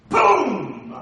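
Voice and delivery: loud triumphant boom; loud exclamation. Real, not acted. Preacher celebrating David's words with a loud boom. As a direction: punch it like a mic drop.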